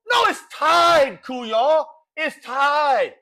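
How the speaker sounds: high, drawn-out shouted correction; exasperated correction. Acted. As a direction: holler it back at the commentator. Mock argument with soccer commentator.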